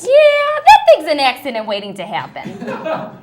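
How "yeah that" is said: loud yeah. Acted.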